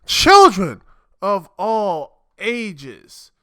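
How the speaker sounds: big, drawn-out ringmaster welcome; show announcer greeting. Pretend. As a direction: open loud, then stretch out the words like a circus announcer. Ringmaster-style greeting opening the show.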